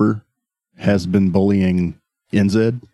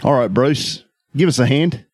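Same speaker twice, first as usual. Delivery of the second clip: put-on a light Australian accent, higher; 5 semitones higher than usual. NZ walks in asking Bruce for help, light Australian accent. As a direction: call out in a breezy Aussie accent. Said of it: "And he says".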